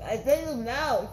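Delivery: chewing while talking; muffled by a mouthful; mouth full. Real, not acted. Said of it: "Don't talk with your mouth full".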